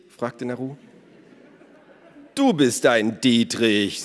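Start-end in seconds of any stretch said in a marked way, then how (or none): 2.3-4.0 loud, slow indignant retort; outraged comeback, drawn out. A narrator's reading. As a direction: throw it back, deeply offended. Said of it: "sagte Elos empört".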